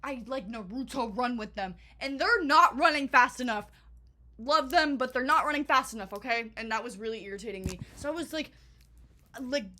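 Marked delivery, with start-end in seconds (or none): 2.0-6.5 loud, higher exasperated complaint; fed up and shouting; frustrated loud. Real, not acted. Friends running too slowly.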